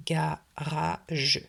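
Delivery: "garage" in three slow beats, GA-RA-GE; slow "garage" with the final "je" sounded; split into syllables. Shown as a demonstration. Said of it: "le couper en trois syllabes".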